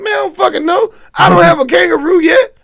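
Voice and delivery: high, loud fake crying wail; mock crying wail. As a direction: whine it out like a kid about to bawl. Mock despair over no kangaroo.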